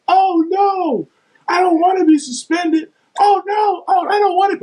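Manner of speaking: very high, whiny mock cry; mock wailing. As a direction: imitate a teammate wailing in panic. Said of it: "Ron started going".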